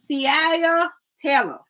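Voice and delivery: "Ciaya Taylor" sounded out slowly, see-AH-yah; careful name attempt. Shown as a demonstration. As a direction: spell out "Ciaya" slowly, unsure of it. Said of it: "I can't even say that".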